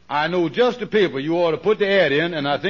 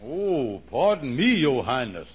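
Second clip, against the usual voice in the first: low mock deference; sarcastic apology; 5 semitones lower, 4 dB quieter, slower than usual. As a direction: drop into dry, exaggerated humility. Mocks his high-class pretensions.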